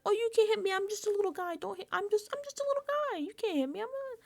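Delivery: high-pitched mock pleading voice; little-guy act. Quoting the little guy in videos, high mock pleading. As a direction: whimper it like a helpless little guy, hammed up. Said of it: "you've seen the videos where you're like".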